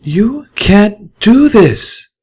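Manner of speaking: high, slow, outraged mare's cry; a horrified mare protesting hard; outraged mare voice, drawn out; Velvet Remedy. A narrator's reading. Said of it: "stomped and snorted".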